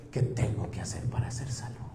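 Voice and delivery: awed, breathless whisper. Acted. Jailer on his knees before Paul, whispering. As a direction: hush the question, trembling in awe.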